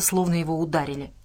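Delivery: ordinary delivery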